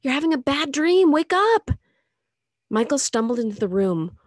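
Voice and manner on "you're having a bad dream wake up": high, loud alarmed command; frantic mother waking her daughter; urgent shout. Acted. Mother shaking dreaming daughter.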